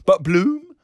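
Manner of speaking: high, loud and pointed; teasing question. A narrator's reading. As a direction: toss the name out high to provoke. Teasing exchange between the barmaids.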